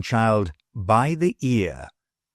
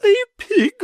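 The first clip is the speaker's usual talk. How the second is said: high, loud alarmed shriek; lady shrieking in alarm; 6 dB louder than usual. A narrator's reading. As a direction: squeal in panic at the pig. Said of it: "shrieked my lady".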